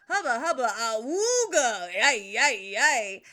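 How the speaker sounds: loud, laughing cartoon leer with a slow awooga; hammed-up catcall. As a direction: whoop it like a smitten cartoon wolf. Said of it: "and Dan's like".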